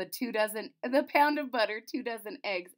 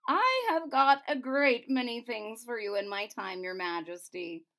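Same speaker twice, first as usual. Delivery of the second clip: a touch louder, stately courtier; pompous chamberlain; 4 semitones higher, 6 dB louder than usual. Acted. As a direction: speak with self-important pride. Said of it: "he said".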